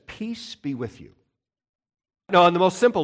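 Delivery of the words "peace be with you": soft and hushed, a quiet blessing; calm the frightened men with a whisper-soft peace; gentle Jesus voice. Acted. Jesus to his panicking disciples, soft and hushed. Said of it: "and he says".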